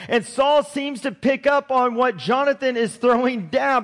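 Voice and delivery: ordinary delivery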